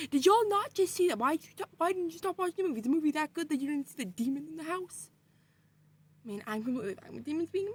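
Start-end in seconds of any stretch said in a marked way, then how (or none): 0.1-5.1 outraged disbelief. Retold or imitated. Family ignores the demon.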